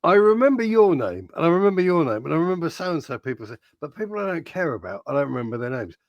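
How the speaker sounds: ordinary delivery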